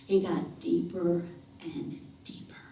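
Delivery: soft, sinking, breathy suspense; ominous storyteller hush. A narrator's reading. Suspense as he goes into swamp.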